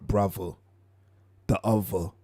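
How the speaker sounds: "Brother" and "other" with V for TH; up top accent. Retold or imitated. Mocking his New York accent, "Brother" and "other" said with a V. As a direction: say "Brother" as "bruvva", "other" as "uvva". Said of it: "It ain't the T H, it's the V".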